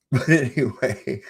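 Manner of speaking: high-pitched words bubbling through laughter; laughing. Real, not acted. Streamer getting back on topic after the Seagal claim, laughing. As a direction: try to move on while giggling. Still amused at the cast claim.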